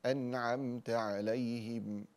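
أنعمت with the mim said clearly, no nasal hum, very slow; clear mim without nasal. Shown as a demonstration. Teacher shows students clear mim, slowly. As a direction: let the mim in أنعمت stand clear and unnasalised. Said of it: "الإظهار ليس بغنة".

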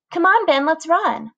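put-on high eager little-girl voice; little sister voice. A narrator's reading. Little sister urging brother.

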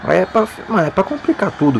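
ordinary talk, nothing marked

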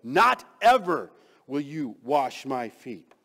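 loud, slow, drawn-out refusal; emphatic no way. Acted. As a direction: refuse loudly, each phrase slow and firm. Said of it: "It's like he's saying".

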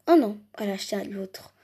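ordinary delivery

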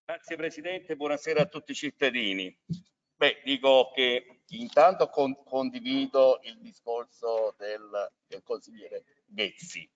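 muffled behind a face mask; talking through a mask. Real, not acted. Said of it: "scusate la mascherina".

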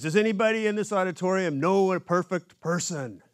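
riled-up preacher. Retold or imitated. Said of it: "getting all riled up".

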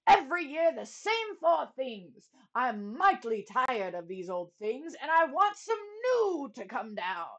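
loud, high, blustering king; booming warrior king. Acted. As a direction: rant like a spoiled king. King bellowing complaint.

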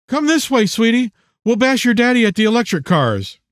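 high, playful woman's voice; playful mother. A narrator's reading. Mother to daughter at the carnival, high playful coaxing. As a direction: pitch it up, coaxing a child along.

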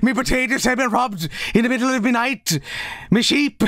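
high, laughing cartoon Irish lament; mock Irish accent. Streamer lamenting stolen potatoes as a joke, exaggerated Irish accent. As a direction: wail it in a hammed-up, high Irish brogue. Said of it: "what part of Ireland is that specifically".

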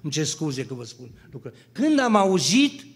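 ordinary delivery

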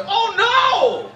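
imitating wife's high alarmed oh no. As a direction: cry it out like disaster struck. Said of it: "it sounds like that".

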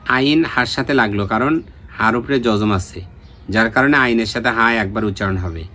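normal talking voice

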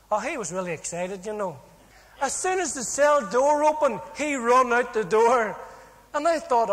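ordinary talk

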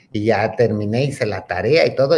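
normal talking voice

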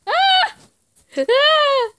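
high, loud screams; frightened screams. Real, not acted. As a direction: scream in fear. Screams during attack.

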